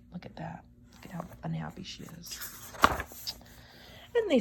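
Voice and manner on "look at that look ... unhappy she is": fast hushed whisper. Real, not acted. Aside to children.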